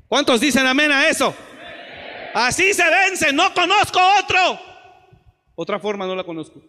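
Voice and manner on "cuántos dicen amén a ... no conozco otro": shouted, much higher call; preaching at full force. Acted. Preacher shouting to the church for amen. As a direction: rouse the congregation to say amen.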